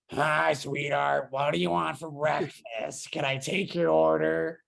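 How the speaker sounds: slow, drawn-out diner waitress voice; Roz. Retold or imitated. Waitress greeting breakfast customers, imitated in a Roz-like drawl. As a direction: imitate a monster-movie clerk taking an order. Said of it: "sounded exactly like Roz".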